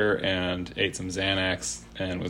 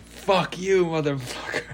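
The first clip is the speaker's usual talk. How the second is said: much higher, yelled-off insult ending in a laugh; mock drunken insult; 9 semitones higher than usual. Fred cursing the guy reading on the hostel roof, laughing through the mock insult. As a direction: fling the curse out, half cracking up. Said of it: "And he's like".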